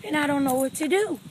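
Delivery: high, panicky report; acted-out alarm to a camp counselor; worked up. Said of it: "they trying to calm me down".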